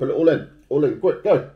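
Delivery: loud, high urging; urgent shout. Real, not acted. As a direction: hurry them on, loudly. Urging friend to eat candy.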